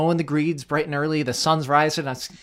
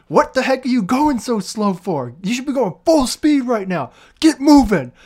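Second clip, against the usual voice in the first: higher and louder, building to a shout; mock boss rant rising to a yell; imitating screaming boss; 9 semitones higher, 5 dB louder than usual. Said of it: "he screamed".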